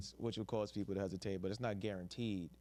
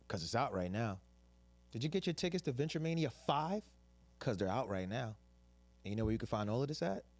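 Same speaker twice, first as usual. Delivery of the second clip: ad read voice; 3 semitones higher than usual. Shown as a demonstration. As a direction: sell it like a radio spot. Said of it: "This is my seductive ad voice".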